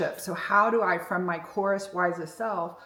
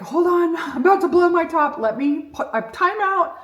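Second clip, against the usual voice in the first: loud, high near-shout, barely holding back; about to blow up, voice climbing loud; mock furious outburst; retold rage; 10 semitones higher, 9 dB louder than usual. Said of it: "I had this huge rage problem".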